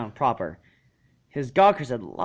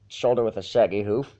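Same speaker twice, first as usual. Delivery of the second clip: ordinary delivery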